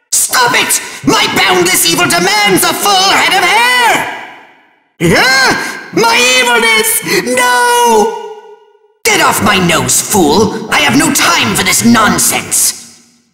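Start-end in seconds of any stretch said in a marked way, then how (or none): 5.9-8.2 high, drawn-out cry of dismay; hammed-up wail of loss; anguished villain wail. Villain losing his evilness, high anguished wail.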